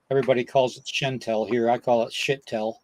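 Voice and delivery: usual speaking voice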